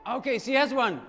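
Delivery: normal talking voice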